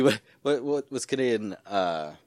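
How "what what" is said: ordinary talk, nothing marked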